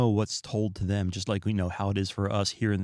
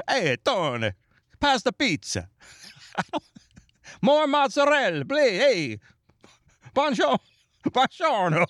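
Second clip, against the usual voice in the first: mock Italian; 11 semitones higher than usual. Imagining his life as an Italian at the dinner table, mock Italian accent. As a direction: ham up a playful Italian-American accent through laughs. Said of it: "speaking with an Irish accent".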